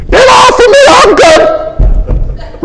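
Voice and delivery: put-on high, shouted old-lady protest; shrill-pitched granny refusing help, hammed up; indignant grandma. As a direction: cry out as a feisty grandma. Grandma snapping as she crosses the sea.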